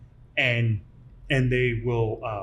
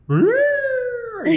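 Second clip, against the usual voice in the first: high, loud rolled "Rrrr" sound; mock growl; 6 dB louder than usual. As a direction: make a silly rolling growl of a failed inflection. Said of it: "me going".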